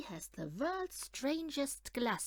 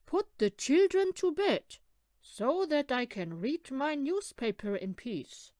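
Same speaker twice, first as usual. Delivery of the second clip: loud, high, bossy order from a stern father; strict father; 3 semitones higher, 7 dB louder than usual. Acted. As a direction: bark it out like a father who wants his peace. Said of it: "Their father is rather strict".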